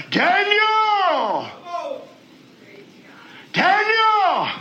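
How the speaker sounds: lamenting cry. Acted. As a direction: call out for Daniel in anguish. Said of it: "he cried out with a lamenting voice".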